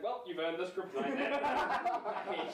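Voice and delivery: mock-solemn Superman, breaking into laughs; imitating Superman. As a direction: hand over the kryptonite while laughing. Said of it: "And Superman's like".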